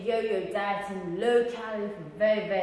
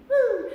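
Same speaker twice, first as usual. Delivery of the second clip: high loud celebratory woo; excited whoop; 8 dB louder than usual. Real, not acted. Trainer talking about his own routine, loud excited whoop. Hyping up a workout video.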